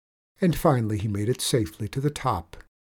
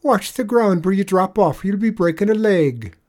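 higher, louder false warning; cries out; 8 semitones higher, 6 dB louder than usual. Acted. Leprechaun calling to the swinging tradesman, loud warning. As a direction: call out to make him look down. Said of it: "cries the leprechaun".

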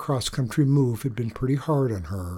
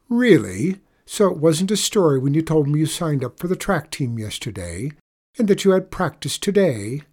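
raised, louder incredulous questioning; challenging interrogation; 3 semitones higher, 4 dB louder than usual. Acted. Mom confronting daughter about track lie, challenging. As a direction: catch the child out in a lie.

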